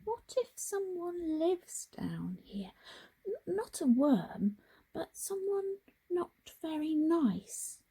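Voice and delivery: high, hushed frightened whisper; nervous whisper. Acted. Frog alone in the dark tunnel, whispering in fear. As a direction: whisper it like you fear being heard. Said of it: "he started to wonder".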